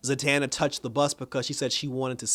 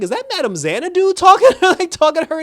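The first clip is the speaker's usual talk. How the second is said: much higher and louder, almost squealing; excited disbelief; 11 dB louder than usual. Real, not acted. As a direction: blurt out the guess in shock. Excited fan guessing the voice.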